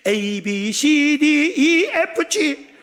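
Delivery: rising into loud, high letters; proud alphabet recital. Acted. Grateful daughter showing father what she learned, proud loud recital.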